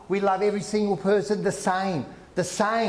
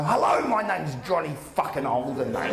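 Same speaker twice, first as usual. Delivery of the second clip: lightly voiced Aussie bloke; 4 semitones lower than usual. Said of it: "Can you just see him ... introducing himself".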